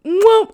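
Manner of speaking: put-on high, smacking baby-kiss noises; imitating his kissing noises on her cheek, high smacks; imitated kiss smacks. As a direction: smack loud kisses like kissing a baby's cheek. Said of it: "imitate the noises".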